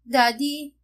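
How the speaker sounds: put-on little girl crying out; tearful call. A narrator's reading. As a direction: daughter calling her father Daddy. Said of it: "Umiiyak na tawag ni Saya".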